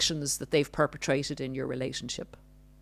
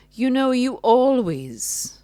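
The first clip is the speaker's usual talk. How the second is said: higher, louder, slow accusing voice; mock narcissist; 9 semitones higher, 5 dB louder, slower than usual. Narcissist belittling partner, slow, higher mocking scold. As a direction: drag out always like a scolding. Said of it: "implies that you do things".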